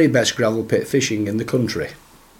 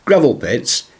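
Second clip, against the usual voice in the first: usual speaking voice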